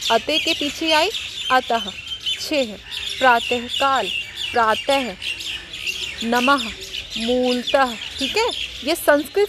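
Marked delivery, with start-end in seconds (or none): 1.5-7.9 अतः, छेह, प्रातःकाल, प्रातः, नमः, मूलतः each with an added breath of h; visarg H sound. Shown as a demonstration. Teacher shows children visarg, an h sound after the vowel. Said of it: "अपने आप से एक ह".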